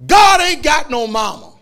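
loud, slow, high declaration; shouted retort. Real, not acted. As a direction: fire it back at the crowd.

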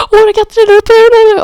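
high, loud, crying plea; tearful pleading. Retold or imitated. Begging the callee to let them in, high tearful plea. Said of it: "gråtandes".

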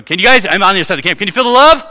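loud playful call from the far side of camp; calling out across the camp. Retold or imitated. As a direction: shout it across the campsite, teasing. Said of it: "And I go".

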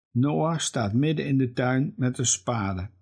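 plain reading voice

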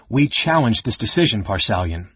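ordinary delivery, nothing marked

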